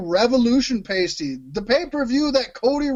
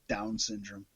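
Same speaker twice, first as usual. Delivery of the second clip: much softer, understated punchline; hushed retold reply; 15 dB quieter, slower than usual. Telling what they answered the coworker, hushed. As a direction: drop to a very soft aside.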